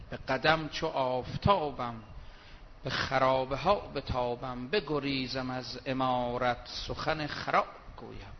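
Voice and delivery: slow, low recited verse; reciting Persian poetry; poem recital. Acted. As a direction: intone the poem slowly and low.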